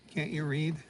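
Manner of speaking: ordinary talk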